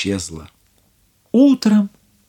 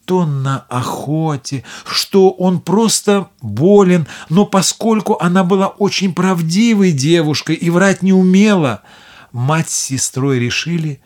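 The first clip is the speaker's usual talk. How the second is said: usual speaking voice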